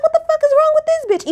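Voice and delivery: incredulous. Retold or imitated. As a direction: react like you can't believe your eyes. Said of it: "I'm like".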